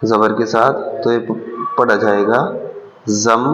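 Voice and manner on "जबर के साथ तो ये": normal talking voice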